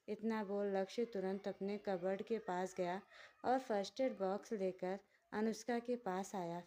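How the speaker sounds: normal talking voice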